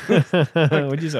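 high, loud, slow laughing question. Real, not acted. Podcast host laughing at the camp kid story, high loud laugh. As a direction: ask it while cracking up.

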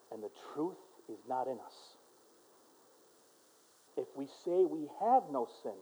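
hushed, low reading with long pauses; solemn scripture reading. Acted. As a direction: read the verse gravely, very softly. Reads scripture aloud, then repeats it.

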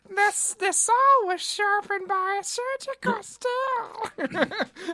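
high, very slow hammy villain. Retold or imitated. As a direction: stretch it out in a high silly voice. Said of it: "him being like".

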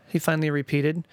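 plain reading voice

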